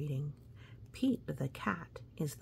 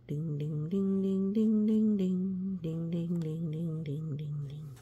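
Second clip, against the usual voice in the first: chime swelling then fading. Acted.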